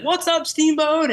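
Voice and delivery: high, loud mock stage shout to the crowd; slow, high, hammed-up stage greeting; rock star greeting. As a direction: call it out like a frontman hitting the stage. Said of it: "he's like".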